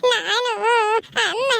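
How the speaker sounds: put-on high wailing little-child voice; acted childish wail, drawn out; crying child. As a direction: cry out for mummy like a toddler. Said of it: "ಅಳ್ಬಾರ್ದು, ಸುಮ್ನಿರಮ್ಮ".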